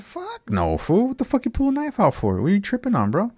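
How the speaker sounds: high-pitched incredulous protest; retold indignant refusal. Refusing to hand back a knife, retold indignation. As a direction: pitch leaps up, volume held back.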